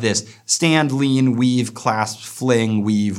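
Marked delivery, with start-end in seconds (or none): none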